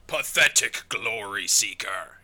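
low, slow, scornful villain; Mordekaiser. Acted. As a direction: sneer it slowly as a dark overlord. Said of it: "first encounter with the Draven quote".

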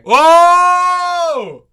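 loud high whoa; shocked shout. Real, not acted. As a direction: yell it out in shock. Reacting to a pickup line.

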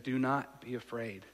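soft, slow comfort; Jesus comforting the grieving father; calm reassurance. Retold or imitated. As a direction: reassure him gently and slowly. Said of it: "he said".

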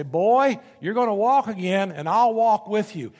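loud, high, forceful nurse's urging; booming rally cry to a patient; big nurse. Retold or imitated. Nurse urging a patient who can't walk, loud and commanding. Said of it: "this great big nurse would pick him up and say".

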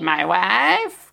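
catchphrase voice, pitch swooping upward; Adam Buxton. Retold or imitated. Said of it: "Copyright, Adam Buxton".

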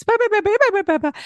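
high mock wailing babble; imitating a toddler's escalating tantrum. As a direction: squeal the bahs like a tantrum. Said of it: "She accelerates".

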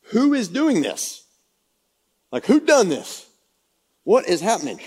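high, loud "who is doing this"; the king, startled and demanding; shocked king. Retold or imitated. King reacts to the plot.

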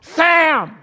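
high, loud mock scream of a name; top of her lungs. Wife screaming the neighbour's name out the window, loud mock yell. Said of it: "screamed at the top of her lungs".

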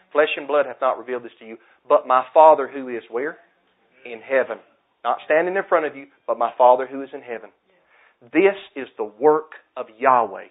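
loud, fast revival preaching; preaching at full force. Acted. Pastor preaching hard to the congregation, loud and driving. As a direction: drive the scripture home to the congregation.

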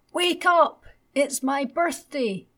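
put-on high, loud excited child's shout; little boy shouting. A narrator's reading. Little Ted wakes his parents on his birthday, loud high child's shout. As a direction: yell it like a small boy waking his parents. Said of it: "Little Ted shouted as loudly as he could".

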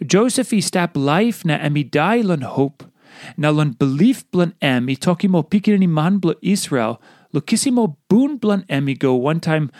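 usual speaking voice

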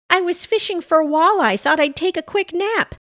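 high-pitched Pam voice; flustered explaining. A narrator's reading. Pam explains why she's out late, high flustered voice.

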